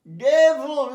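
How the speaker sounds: ডেভিল loud and clear, dev-il; correct pronunciation. Shown as a demonstration. Teacher loudly modelling a tricky word for the class. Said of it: "উচ্চারণ ডেভ, V I L".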